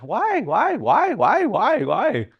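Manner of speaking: high, rapid-fire mock "why" on repeat; pestering whys. Someone questioning everything, high pestering whys. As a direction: pester like a kid who won't stop asking.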